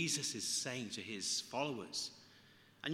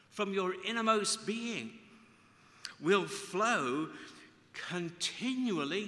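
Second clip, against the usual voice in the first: preaching; 7 semitones higher, 6 dB louder, slower than usual. Acted. Preacher proclaims scripture.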